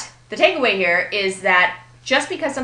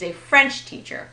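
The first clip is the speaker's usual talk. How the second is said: FRENCH punched, teacher falling away after it; a FRENCH teacher, compound stress; stress on first word; 4 semitones higher than usual. Shown as a demonstration. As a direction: hit French and run teacher onto it lightly. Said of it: "Notice the stress on the first word".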